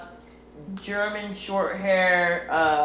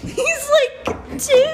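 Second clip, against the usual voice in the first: much higher, incredulous; much slower than usual. Real, not acted. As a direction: protest in disbelief. Defending cousin.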